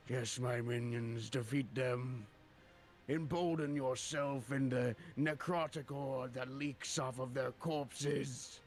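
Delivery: slow, loud death giant commanding his horde; Shadner Kyle. Acted. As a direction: drag out the villain's commands, booming to the minions. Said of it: "as Shadner Kyle, the great death giant, I will say".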